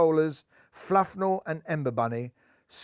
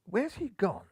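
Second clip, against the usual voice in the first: puzzled; 6 semitones higher, 5 dB quieter than usual. A narrator's reading. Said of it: "inquired a puzzled Goldenhair".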